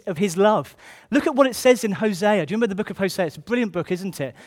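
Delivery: ordinary talk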